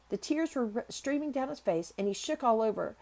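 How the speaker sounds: usual speaking voice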